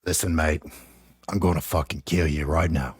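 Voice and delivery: low British tough-guy accent, mock menace; Jason Statham. Shown as a demonstration. Host demonstrates an accent for his friend to mimic, low British menace. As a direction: threaten in a deep-pitched British movie hard-man voice. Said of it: "That's my best Jason Statham".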